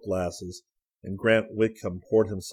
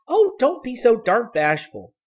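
put-on high, loud girl's voice scolding; impatient Sally; 9 dB louder than usual. A narrator's reading. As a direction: chide a shy sister, high and loud. Sally teasing her timid sister.

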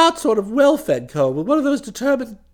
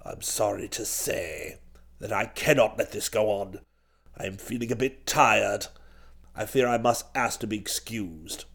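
put-on very low, soft old duke; rummy voice; 14 semitones lower, 8 dB quieter than usual. A narrator's reading. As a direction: excuse yourself wearily in a low, odd voice. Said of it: "in that same rummy voice".

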